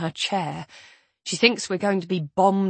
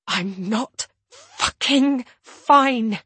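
slow, higher, each word an assault; clipped, hostile whisper; 5 semitones higher, 4 dB louder, much slower than usual. A narrator's reading. Isabel up close to his ear, clipping each word angrily. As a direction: attack with every separate word. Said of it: "clipping each word into an assault".

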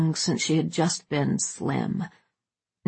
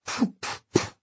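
puffed fart noises; 5 dB quieter than usual. Acted. Imitating the farting sound.

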